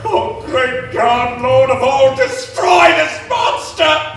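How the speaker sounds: slow, loud rising cry to heaven; anguished plea to God. Acted. Frankenstein crying to God to destroy the monster, slow anguished plea. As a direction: call down God's wrath.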